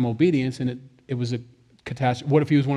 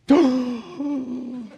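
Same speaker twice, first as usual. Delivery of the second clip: imitating a startled gasp; alarmed gasp; 11 semitones higher than usual. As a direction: gasp in shock like a worried mother. Mom reacting in alarm.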